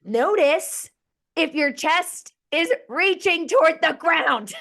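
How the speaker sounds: slow, high, loud yoga cue; mock-exasperated teacher shouting each instruction out slowly; screaming into mic. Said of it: "I'm screaming into my mic".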